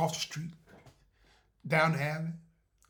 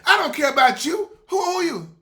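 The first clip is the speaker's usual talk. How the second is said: high, loud upset yell; angry heckler yell; 9 semitones higher, 16 dB louder than usual. Retold or imitated. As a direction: shout back at the speaker. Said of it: "they said".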